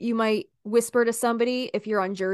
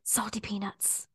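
whisper; 9 dB quieter than usual. Shown as a demonstration. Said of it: "you have to say this".

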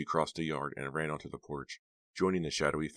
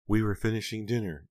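higher, louder tearful account; sobbing aunt; 6 semitones higher, 5 dB louder than usual. A narrator's reading. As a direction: tell it in a raised, grief-struck voice. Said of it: "still sobbing".

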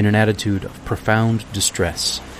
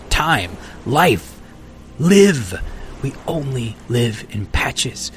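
agitated stranger; 3 semitones higher than usual. Acted. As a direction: let the weary man's bitterness surge up. Stranger's rising tirade.

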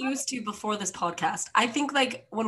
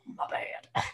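whisper; 7 dB quieter than usual. Real, not acted. Repeating apology under breath.

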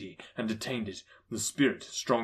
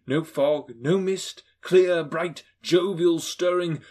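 ordinary delivery, nothing marked

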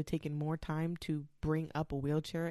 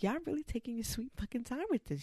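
exasperated sarcasm; 7 semitones higher than usual. Retold or imitated. Impatient aside while in labor.

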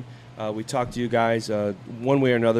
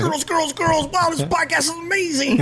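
high, hammed-up fan squeal; mock excited fans. Host mocking eager male fans, high and hammed-up. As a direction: gush like a fan over the girls.

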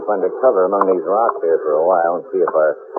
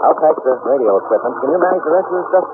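calling over engine; 9 semitones higher than usual. Acted. Shouting over plane engine.

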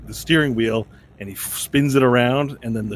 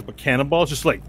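normal talking voice

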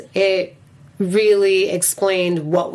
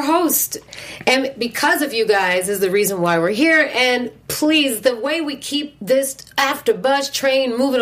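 promo read; 5 semitones higher than usual. Acted. Reads the show's subscribe plug.

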